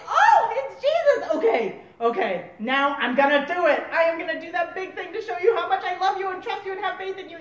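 excited, gushing burst of zeal; loud gasp of recognition into hurried promises; hammed-up overeager volunteering; eager Peter. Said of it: "we're Peter".

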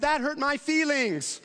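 put-on high, slow little boy voice with a laugh; a wounded child, half laughing; hurt son. Son telling dad he hurt his feelings, in a lightly voiced child tone. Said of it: "and he said".

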